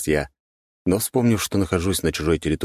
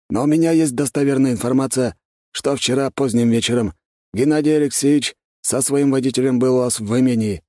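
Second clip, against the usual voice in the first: higher-pitched gendarme chief, lightly voiced; 9 semitones higher, 4 dB louder than usual. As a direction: lightly play an official reporting information, pitched up. Голос главы жандармов в диалоге. (слегка сыгранный начальник жандармов, голос повыше)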